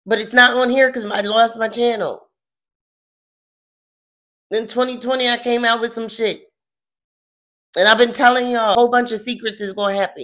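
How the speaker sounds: loud, high, insistent outburst; worked-up shouting. Real, not acted. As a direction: raise your voice to insist you warned them. Agitated, insisting on past predictions.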